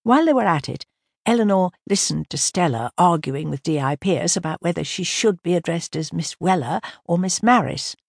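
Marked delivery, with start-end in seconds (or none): none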